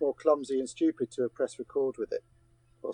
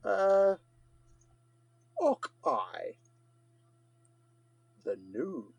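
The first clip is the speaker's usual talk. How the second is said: put-on Scottish accent; 3 semitones higher, 6 dB quieter, much slower than usual. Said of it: "Anything further to add?".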